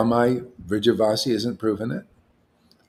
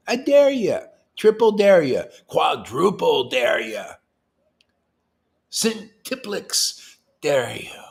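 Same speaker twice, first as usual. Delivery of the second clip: taunting dare; 6 semitones higher, 4 dB louder, slower than usual. Real, not acted. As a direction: goad them, drawing out each new dare.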